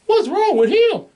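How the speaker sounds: squeaky, panicked high pitch; scared high voice. Retold or imitated. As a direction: yelp it like a frightened goof. Bulk and Skull cowering.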